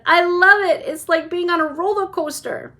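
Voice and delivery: high, loud delighted shout; shouting with joy. A narrator's reading. Charlie on the wild ride, delighted shout. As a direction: yell with thrill. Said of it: "Charlie shouted".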